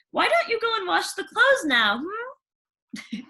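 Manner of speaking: high, sugary stepmother; fake-sweet disapproving question; prim stepmother sniffing at dust. Stepmother voiced.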